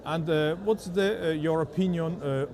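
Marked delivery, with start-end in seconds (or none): none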